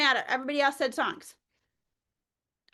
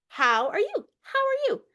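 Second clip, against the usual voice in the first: high sing-song; 9 semitones higher than usual. Shown as a demonstration. Sung greeting.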